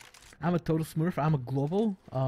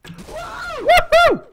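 excited; 11 dB louder than usual. Real, not acted. Celebrates a headshot.

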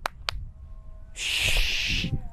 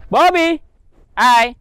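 calling out; 8 semitones higher, 6 dB louder, much slower than usual. Real, not acted. Calling bird back.

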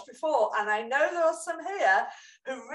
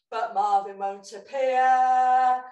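put-on overbright kids party host; 4 semitones higher than usual.

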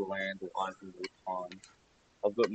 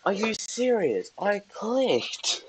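much higher, slow, disbelieving; high exasperated whine; 13 semitones higher, 4 dB louder, much slower than usual. Real, not acted. Disbelief after dying.

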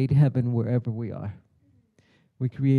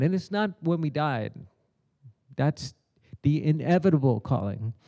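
ordinary delivery

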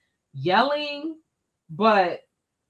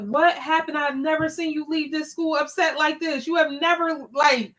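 higher, worried pressing questions; husband asking his upset wife what went wrong, higher and concerned; 7 semitones higher than usual. Retold or imitated. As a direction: press gently for what happened.

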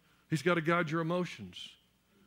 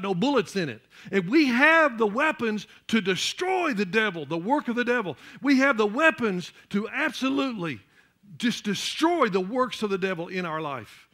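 fervent preaching; 4 semitones higher, 5 dB louder than usual. Acted. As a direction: drive it home to the congregation. Preaching at full force.

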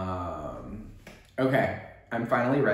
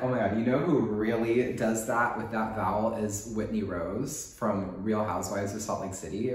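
ordinary delivery

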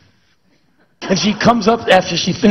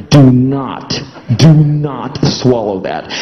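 slow, low firm warning; stern inner warning; 4 semitones lower, slower than usual. Acted. Body's software warning him not to swallow, slow and firm. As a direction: command like a machine override.

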